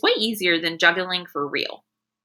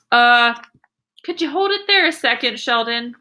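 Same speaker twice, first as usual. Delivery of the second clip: calling out; 3 semitones higher than usual. Acted. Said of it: "he called".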